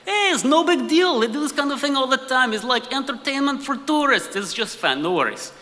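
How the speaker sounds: put-on high, breezy Russian-accented English; Russian colleague's dismissive accent, starting high and settling down. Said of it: "in true Russian fashion".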